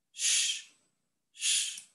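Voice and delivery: unvoiced shh, fading; a soft whispered hush to silence him. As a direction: hush him gently without voice. Annie hushes Getty again.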